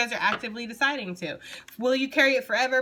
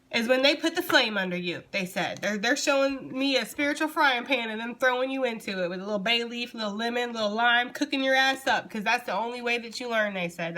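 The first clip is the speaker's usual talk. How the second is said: ordinary talk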